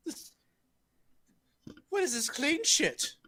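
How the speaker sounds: high-pitched dwarf heckle; put-on voice of a disgusted dwarf spectator; complaining dwarf. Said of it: "you see the dwarves just go".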